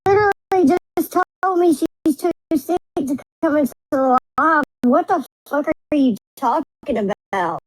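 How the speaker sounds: squeaky, way up high like helium; high-pitched voice. Real, not acted. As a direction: talk in a tiny squeaky voice. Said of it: "You got a voice changer on".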